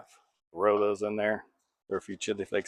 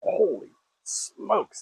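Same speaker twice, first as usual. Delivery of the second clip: surprised; 10 semitones higher, much slower than usual. Real, not acted. Surprised at high heat.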